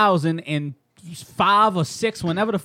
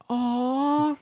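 higher drawn-out "aw"; imitated groan; 8 semitones higher than usual. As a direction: draw out a long, high moan. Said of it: "if she was like".

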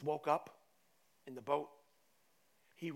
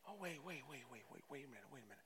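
dawning realization; 2 semitones lower, 12 dB quieter, much faster than usual. Acted. Disciples realizing what Jesus meant, hushed muttering. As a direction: murmur it as it sinks in.

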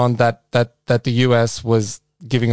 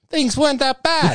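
higher, laughing through the words; 12 semitones higher than usual. Real, not acted. Joking to his co-host that Trump years weren't bad, laughing. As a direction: giggle it out.

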